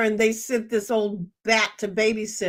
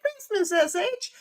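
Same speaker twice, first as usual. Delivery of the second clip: put-on quoting Daisy Girl; 11 semitones higher than usual. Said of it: "Daisy Girl is like".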